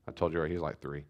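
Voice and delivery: normal talking voice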